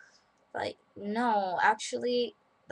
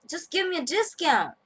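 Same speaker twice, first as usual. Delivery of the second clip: high, loud pushy demand; mock-whiny request, much higher; quoted client; 12 semitones higher, 7 dB louder than usual. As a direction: pester for a discount in a squeaky voice. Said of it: "he says something like".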